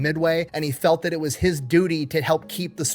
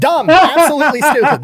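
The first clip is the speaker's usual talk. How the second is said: loud, higher, disbelieving cry of stupid; exasperated outburst; 8 semitones higher, 10 dB louder than usual. Real, not acted. Host reacting to the dumb cadet, a loud exasperated outburst. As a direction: let it burst out in disbelief.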